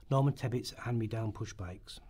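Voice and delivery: usual speaking voice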